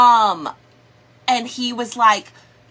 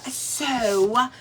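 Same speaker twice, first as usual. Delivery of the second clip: normal talking voice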